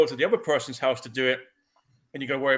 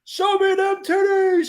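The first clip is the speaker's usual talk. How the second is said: high, loud, stretched-out holler; hammed-up lewd heckle; rowdy heckler shout; 7 dB louder, slower than usual. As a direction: yell it like a cinema catcaller. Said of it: "probably hear somebody saying that".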